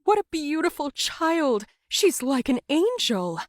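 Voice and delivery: high delighted voice of a churchgoer; admiring woman. Acted. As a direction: gush over the child. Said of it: "one woman said".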